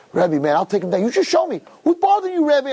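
mock tough guy getting worked up and high; tipsy bravado. Tipsy student offering to protect his rebbe, high-pitched bravado.

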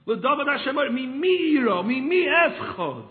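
loud, high impassioned verse, slowing at the end; preaching at full force; fervent preaching. Acted. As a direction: cry the verse out to the congregation. Opens with fervent chanted verse.

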